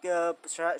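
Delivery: ordinary delivery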